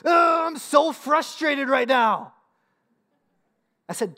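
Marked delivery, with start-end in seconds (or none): 0.0-2.3 loud, drawn-out mock tantrum; hammed-up stomping frustration, high and slow; frustrated child. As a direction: imitate a small girl's exasperated outburst. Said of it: "she stomped her feet".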